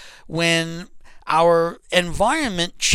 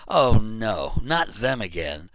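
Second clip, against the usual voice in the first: dreading groan; 6 semitones lower, slower than usual. Retold or imitated. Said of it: "you're thinking to yourself".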